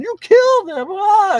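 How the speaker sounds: loud, very high hammed-up wail; mock crying. Retelling the other guy after the killing, mock crying wail. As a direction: cry out in exaggerated mock grief. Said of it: "The other guy's crying".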